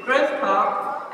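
ordinary delivery

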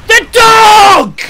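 very high, drawn-out yell; sudden shout. Real, not acted. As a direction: yell it out at full volume. Sudden shout about the dog.

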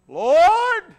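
high, loud, long-held "Lord"; lone crying voice. Acted. Thief's lone voice amid jesting and mockery, crying out. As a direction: cry out over the roar. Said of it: "one lone voice".

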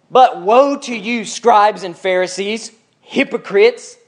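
slow, declamatory denunciation; serious, upset. Acted. Jesus rebuking scribes and Pharisees, stern and upset. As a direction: pronounce each phrase as a solemn condemnation. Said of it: "He's a little serious".

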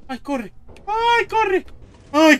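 high frightened shriek; panicked high cry. Real, not acted. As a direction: squeal it while fleeing. Fleeing monster.

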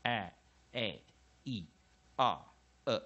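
five short vowels, each said separately; short vowel sounds. Shown as a demonstration. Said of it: "通常短母音就是".

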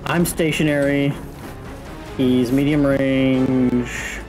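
ordinary talk

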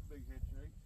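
normal talking voice

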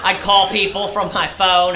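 ordinary talk

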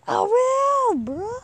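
squeaky, loud and high; teasing high squeal. Retold or imitated. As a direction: squeal it like a cheeky teen.

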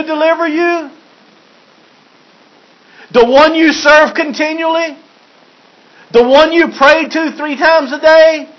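the worried king begging for an answer; a king's fearful questions shouted down the den; anxious king calling. Acted.

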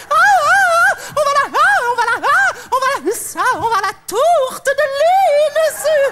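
acted ecstatic climax. Carried away despite sleeping Rose, acted ecstatic cries. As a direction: let moans cut through the words.

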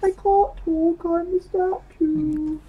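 very high, slow silly voice; put-on talking statue. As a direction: stretch it out in a squeaky falsetto. Joking put-on voice after demo.

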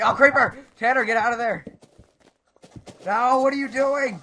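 high, loud warning yell; panicked shout. Real, not acted. Player warns teammate about a creeper, panicked shout. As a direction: yell urgently to save your teammate.

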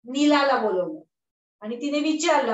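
usual speaking voice

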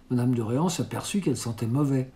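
ordinary delivery

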